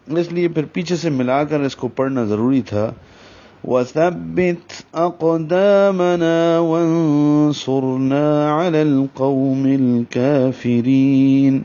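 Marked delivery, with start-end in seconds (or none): 3.6-11.6 slow melodic tajweed recitation; Quran recitation. Acted. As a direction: chant the verse slowly and evenly. Recites the verse.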